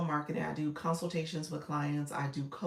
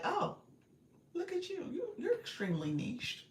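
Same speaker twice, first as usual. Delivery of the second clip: high, mocking condescending voice; 13 semitones higher than usual. Streamer mimicking agency contact's condescending tone, high and mocking. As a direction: talk down to her like she's cute. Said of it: "like this condescending tone".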